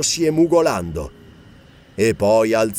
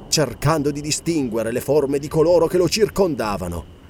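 plain reading voice

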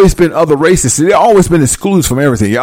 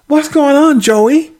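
wife's reply; 11 semitones higher, slower than usual. Retold or imitated. Wife answering Joel.

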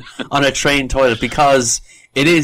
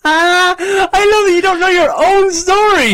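high, loud, drawn-out cackling tease; delighted teasing; 7 dB louder, slower than usual. Real, not acted. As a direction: crow it loudly through laughter. Teasing co-host over forgotten story.

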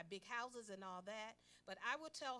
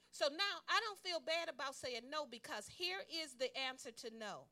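loud, high, fervent preaching; preaching at full force; 6 semitones higher, 10 dB louder than usual. Acted. Preacher urges the congregation to say no. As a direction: rouse the church to agree.

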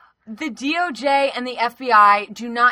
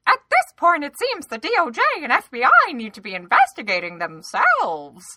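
mock Nunes quote; 6 semitones higher, 4 dB louder than usual. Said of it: "That's what Nunes said".

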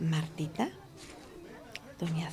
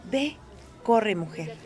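ordinary talk

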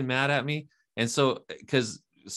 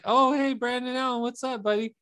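mock friendly greeting; 10 semitones higher than usual. Mock cheerful greeting in story.

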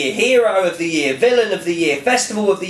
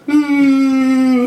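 held-in yawn, a tight higher hum through closed lips; stifled yawn hum; 7 semitones higher, 5 dB louder than usual. Retold or imitated. Imitating a stifled yawn to mock bored bus passengers. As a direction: hum it like you're swallowing a yawn. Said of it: "the face that you pull".

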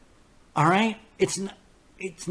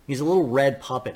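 normal talking voice

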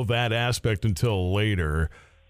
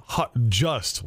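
normal talking voice

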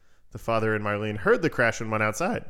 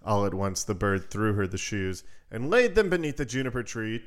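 usual speaking voice

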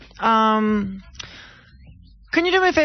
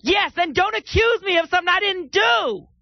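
higher, shouted protest; yelling back; 5 semitones higher than usual. Acted. Caller shouting at the accusing girlfriend, mock outrage. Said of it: "Don't yell at me".

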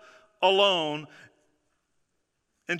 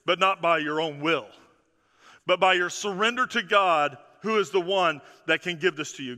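ordinary delivery

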